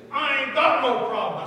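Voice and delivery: mildly heated mock retort; mad retort. Preacher quoting the driver at the hospital, mildly mad retort. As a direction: push back, lightly imitating an irritated man. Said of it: "mad, he said".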